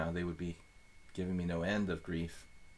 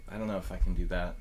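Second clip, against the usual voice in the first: ordinary talk, nothing marked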